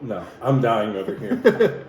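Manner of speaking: laughing through the burn; talking with a burning mouth; mouth burning from spicy food. Real, not acted. Mouth on fire from hot sauce.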